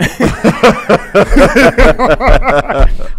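long loud laughter; falling about laughing. Real, not acted. Laughing at the club story.

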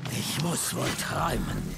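stunned. Acted. Stunned at unexpected arrival.

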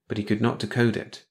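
ordinary delivery

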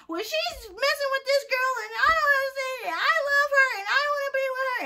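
high, whiny mock of the jealous ex. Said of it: "looking hella stupid like".

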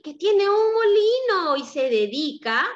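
plain reading voice

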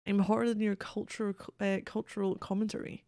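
ordinary talk, nothing marked

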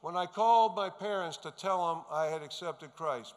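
ordinary talk, nothing marked

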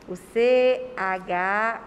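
usual speaking voice

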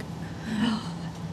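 soft, breath-only gasp; mock gasp. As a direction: act out a quiet gasp of dismay. Acting Cinderella's dismay at escort.

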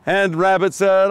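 ordinary talk

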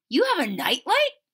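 can't believe it; amazed boy. Acted. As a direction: Chester amazed at Lily's nightlight. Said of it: "said Chester".

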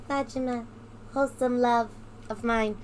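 soft, dreamy lovesick murmur; creepy fangirl swoon. Pretend. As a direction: sigh it like a smitten fan. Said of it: "I sound like a creepy fangirl".

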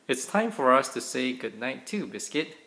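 usual speaking voice

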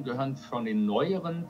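normal talking voice

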